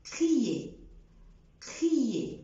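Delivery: crier ending on e; silent r. Shown as a demonstration. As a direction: drop the r. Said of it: "ما بقولش". (crier من غير R)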